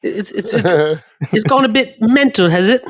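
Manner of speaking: amused mock-English impression; Stephen Grant. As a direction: toss out British slang as a bewildered Englishman. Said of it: "do your best Stephen Grant impression".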